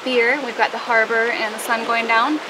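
usual speaking voice

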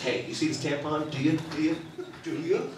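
commercial spokeswoman. Retold or imitated. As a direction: lightly imitate a hushed ad presenter. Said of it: "The woman will come out, she goes".

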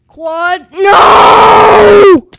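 huge, high drawn-out scream of defeat; full-blast gamer howl of dismay; anguished scream. Real, not acted. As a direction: scream out as the jump fails. Failing a jump late in course.

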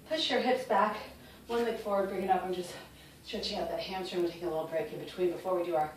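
usual speaking voice

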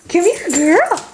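high puppy talk, beckoning; high pet coaxing. Real, not acted. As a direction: coax her over sweetly, high. Coaxing the dog closer.

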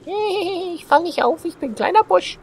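high, slow cartoon voice; silly high voice. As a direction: squeak like a little bush. Playful silly voice as bush.

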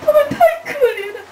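high, wailing mock cry half laughing; 学他哭着说, 又哭又笑; imitated sobbing. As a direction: mimic a partner crying over the story. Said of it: "我哭到这样说".

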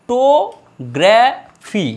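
ফটোগ্রাফি very slowly, in four beats; ফ - টো - গ্রা - ফি with a gap after each; syllable by syllable. Shown as a demonstration. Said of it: "চারটা syllable রয়েছে".

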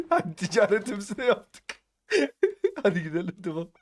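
high, giggling delivery; laughing while talking. Real, not acted. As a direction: squeeze the words out through laughter. Laughs after the trade.

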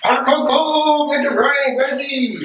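high, slow hype call; hyped. Acted. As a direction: hype it up like a party. Hyped pouring cocoa.

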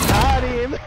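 loud, high triumphant yell; excited shout. Real, not acted. Gamer shouting to his squad after a kill, triumphant yell.

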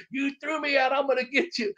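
high-pitched Boston wrestler impression; Kevin Sullivan. Wrestler vowing revenge on the man who threw him out, in Boston-guy voice. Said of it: "But he's doing that".

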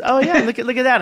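high, mock-impressed "oh, look at that"; stylist's reaction. Hairdresser eyeing his home-cut hair, high mock-impressed remark. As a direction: play the hairdresser pretending to admire it. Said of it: "and she goes".

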